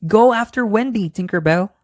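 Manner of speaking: high urgent command; exclaimed. Acted. Peter ordering Tinkerbell after Wendy, urgent. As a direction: send her off urgently. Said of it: "Peter exclaimed".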